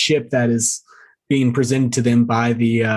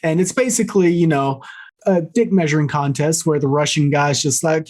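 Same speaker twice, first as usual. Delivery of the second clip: ordinary talk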